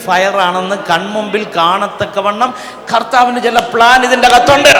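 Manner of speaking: loud, building revival proclamation; full-throated preacher's build to a climax; fiery preaching. Real, not acted. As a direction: proclaim it with mounting force, climbing to a high shout.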